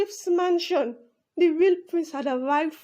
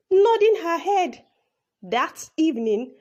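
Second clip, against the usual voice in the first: plain reading voice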